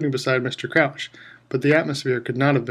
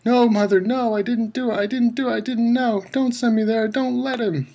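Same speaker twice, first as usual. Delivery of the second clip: much higher, loud pleading cry; terrified screaming; 10 semitones higher, 4 dB louder than usual. A narrator's reading. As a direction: scream in panic to your mother. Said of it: "The boy began to scream".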